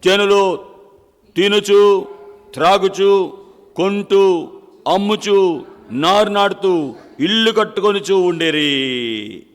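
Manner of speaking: loud pulpit cadence; preaching at full force. Acted. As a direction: proclaim it to the congregation. Preacher declaims list of activities.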